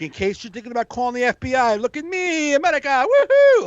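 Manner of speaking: rising into a high, loud cheer; mock patriot. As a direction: overdo the patriotic woohoo. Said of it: "It's like".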